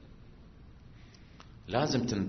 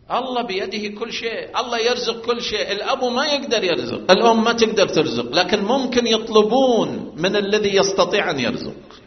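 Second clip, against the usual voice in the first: raised, louder preacher's voice; preaching at full force; 8 semitones higher, 8 dB louder than usual. Acted. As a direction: drive the point home to the congregation.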